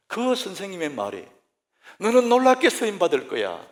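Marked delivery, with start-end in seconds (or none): 2.0-3.7 emphatic blessing. Retold or imitated. Teacher's blessing repeated.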